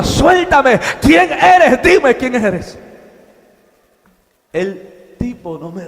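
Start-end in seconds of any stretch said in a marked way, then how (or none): 0.0-2.8 loud, high-pitched challenge to a captor; shouted demand. Acted. Man confronting the stranger gripping his arm, shouted demand. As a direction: demand it forcefully, voice raised.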